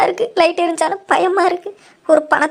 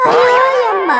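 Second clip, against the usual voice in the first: high crying yelp; frightened cry; 6 semitones higher, slower than usual. Acted. Woman hearing the ghost voice, crying out in fright. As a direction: shriek of fright.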